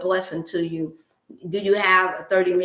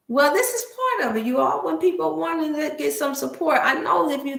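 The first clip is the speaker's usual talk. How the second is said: choked up; 7 semitones higher than usual. Real, not acted. To viewers, grieving people lost without support, choked up. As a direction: voice pitched up high, near tears. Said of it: "I'm finna get choked up".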